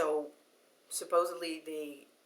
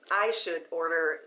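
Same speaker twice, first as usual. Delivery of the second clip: normal talking voice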